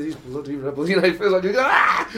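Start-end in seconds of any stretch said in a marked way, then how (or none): 1.6-2.1 loud laughing mock outburst; mock frustrated yell. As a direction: burst out, half laughing. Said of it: "properly lose it".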